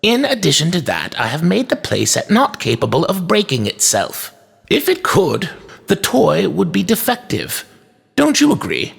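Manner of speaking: put-on high-pitched, precise old shopkeeper; firm toy maker. A narrator's reading. Toy maker argues with inspector, firm and polite. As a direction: reason with him politely in a high voice.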